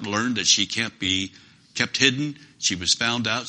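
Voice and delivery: ordinary talk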